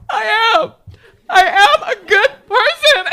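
high, loud laughing sob, slow; overwhelmed shopper wailing at the cashier, loud and high; sobbing self-praise. Retold or imitated. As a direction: blubber it out through happy tears. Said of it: "And I'm going".

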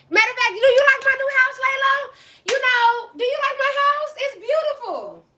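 high, sugary, teasing host voice; fake sweet voice. Streamer teasing Layla in a high, sugary put-on voice. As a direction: lay the sweetness on thick and high.

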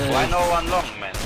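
loud, exasperated question; frustrated shout. Real, not acted. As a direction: yell it at teammates. Angry at team positioning.

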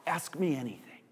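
suddenly very soft surrender; quiet, intimate prayer; hushed prayer. Acted. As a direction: drop right down, almost to a whisper.

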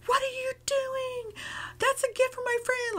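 high, upset retold scolding; mad outburst. To brother eating the gift chocolates, high angry scolding. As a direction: catch him red-handed and let him have it. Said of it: "Like, I was so mad".

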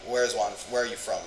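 normal talking voice

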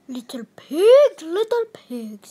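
wolf calling loudly at the stick house; called. A narrator's reading. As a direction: call out to the pigs inside. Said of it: "called the wolf".

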